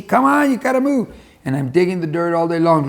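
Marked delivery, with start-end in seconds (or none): 0.0-1.1 a high-pitched mock of the farmer driving him on; pushy farmer. Bull mimics the farmer whipping him, high-pitched and bossy. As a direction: hurry the bull along in a bossy, squeaky voice.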